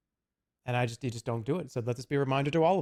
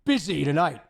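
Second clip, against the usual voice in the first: curt brush-off; 2 semitones higher, 6 dB louder than usual. Pretend. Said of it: "And I'm like".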